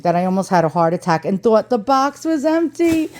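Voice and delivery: ordinary delivery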